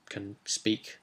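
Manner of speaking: ordinary talk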